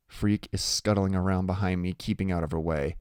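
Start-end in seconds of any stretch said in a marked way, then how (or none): none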